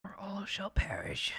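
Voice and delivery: soft whispered doom; ominous whisper. Pretend. Ghost warning a friend of doom, whispered. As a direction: breathe the threat like a curse.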